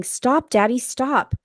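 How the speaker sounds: imitating a desperate child yelling; imitating children screaming. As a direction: cry out to Daddy like a scared kid. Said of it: "jumping up and down and screaming".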